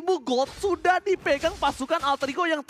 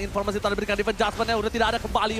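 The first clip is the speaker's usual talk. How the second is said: ordinary talk, nothing marked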